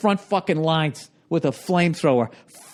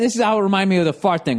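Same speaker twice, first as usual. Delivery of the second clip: usual speaking voice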